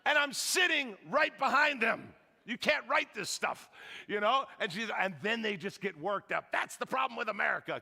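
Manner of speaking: ordinary talk, nothing marked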